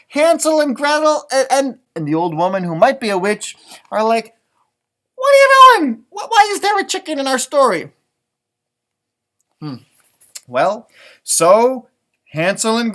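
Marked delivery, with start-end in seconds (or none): 5.1-7.9 high, loud startled question; baffled outcry. A narrator's reading. Hansel and Gretel confront the chicken, high startled cry. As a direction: demand it, thrown by the intruder.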